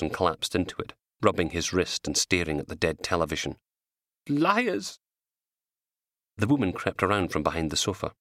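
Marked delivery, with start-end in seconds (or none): 4.3-5.0 high bitter accusation; bitter outburst. A narrator's reading. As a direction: spit out the word. Knox staring at the TV.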